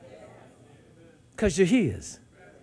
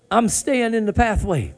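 ordinary talk, nothing marked

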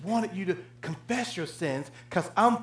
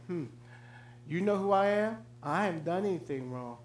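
smug; 9 dB quieter than usual. Retold or imitated. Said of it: "with your smug face and say".

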